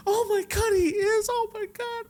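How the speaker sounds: very high, hammed-up tearful relief; fake crying. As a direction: wail like a wife putting on an act. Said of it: "She's still fucking playing the fucking part".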